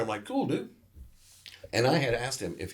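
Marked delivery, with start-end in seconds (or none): 0.3-0.7 high, breezy cool-dude reply; mock casual dude. Telling his friend he's excited to meet mom, breezy dude voice. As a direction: toss it off like a laid-back surfer.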